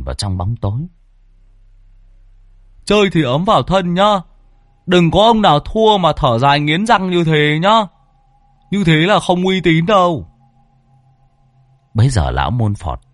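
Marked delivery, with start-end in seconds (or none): 2.8-10.3 higher, louder taunting voice; winning cardsharp mocks the players in the dark; smug winner. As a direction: needle the sore losers. Winner mocks grumbling losers.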